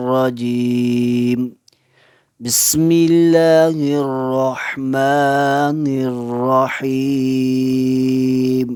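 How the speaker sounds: slow, drawn-out recitation; Quranic recitation. Acted. Teacher opens the lesson with a slow recited invocation. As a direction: intone it slowly and reverently.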